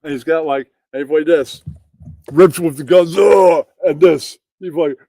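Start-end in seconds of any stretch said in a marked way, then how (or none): 3.2-3.7 flexing grunt. Retold or imitated. Acting the buff guy flexing.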